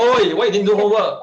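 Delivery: high, loud mock telling-off; scolding parent. Parent to child over missed homework, mock scolding.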